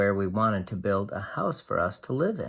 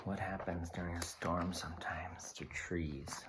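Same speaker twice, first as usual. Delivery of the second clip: breathy whispered question; 5 semitones lower, 16 dB quieter than usual. Pretend. Asking the children a suspenseful question, whispered. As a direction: hush it like a secret.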